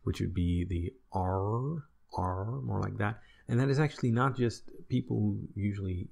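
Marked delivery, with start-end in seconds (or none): 1.1-2.6 R drawn out with an untrilled English sound. Shown as a demonstration. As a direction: stretch R as an English "arr". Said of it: "The English R".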